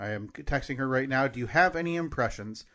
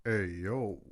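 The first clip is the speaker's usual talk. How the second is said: low, soft boxer call; Sylvester Stallone as Rocky; 8 semitones lower, 8 dB quieter than usual. Acted. Taking one more whack at it, low Stallone voice. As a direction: drop deep and quiet into a slurred boxer greeting. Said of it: "fix the Adrian on the end here".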